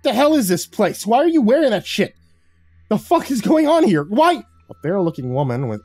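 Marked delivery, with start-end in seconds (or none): 0.0-4.4 furious rant pitched much higher and louder; angry snarl. A narrator's reading. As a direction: fight the grip and rage. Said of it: "struggled in his iron grip and snarled".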